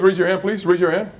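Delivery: normal talking voice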